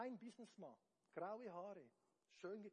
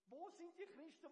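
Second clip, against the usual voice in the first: ordinary talk, nothing marked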